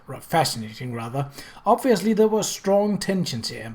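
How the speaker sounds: ordinary delivery, nothing marked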